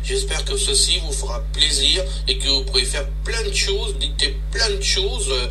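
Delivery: played back through a phone speaker; distant tinny playback; dictaphone recording. Real, not acted.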